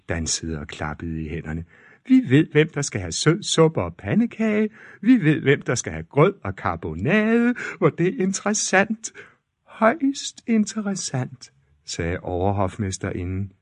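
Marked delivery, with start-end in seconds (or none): 2.1-11.5 high, prim court-lady voice; fussy lady-in-waiting, pitched high; gossiping court ladies. Acted.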